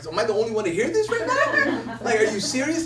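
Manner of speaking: incredulous, laughing through it; half-laughing are you serious; laughing disbelief. Retold or imitated. Asking the room in laughing disbelief. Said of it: "I looked around like".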